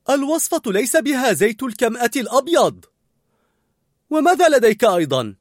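loud, high-pitched scolding; angry. A narrator's reading. Cook spotting a wrong ingredient, angry scolding. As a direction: challenge him angrily over every ingredient. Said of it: "هزت كوليت رأسها غاضبة".

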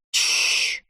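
soft unvoiced shush; librarian shushing whisper. A narrator's reading. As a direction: hush them with a long shh. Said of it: "снова громко шикнула библиотекарша".

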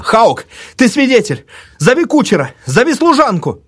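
high-pitched, insistent orders; urgent shouting. A narrator's reading. Narrator grips the old woman, shouting for witnesses. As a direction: call out commands urgently.

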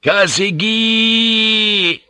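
high, long drawn-out war cry; battle cry. A narrator's reading. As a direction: stretch the cry out high as you charge. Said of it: "charged with their battle cry".

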